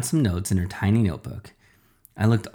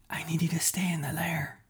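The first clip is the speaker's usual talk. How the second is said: unvoiced urgent whisper; 8 semitones higher, 9 dB quieter than usual. Dad whispering warning to his son on phone. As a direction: breathe it, barely voiced, urgent. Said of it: "he whispered".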